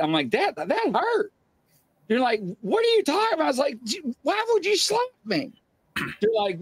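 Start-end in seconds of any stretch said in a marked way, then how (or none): 0.3-1.3 high, slow, aggrieved complaint; hurt protest. Retold or imitated. Slapped sleeper complains to family, hurt protest. Wounded protest after slap. 2.6-3.4 very high incredulous question; imitated bafflement. Family accused of slapping sleeper, imitated bafflement. 3.8-5.5 very high, exasperated mock demand; indignant accusation. Slapped sleeper presses wife and grandmother, indignant accusation.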